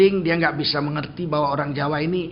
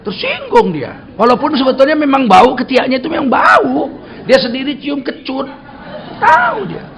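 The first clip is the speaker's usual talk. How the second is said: higher and louder, shouting the point home; fiery preaching; 6 semitones higher, 6 dB louder than usual. Acted. Preacher shouting to the congregation about taking offence, fiery preaching. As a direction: preach it hard to the crowd.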